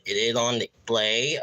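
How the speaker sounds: normal talking voice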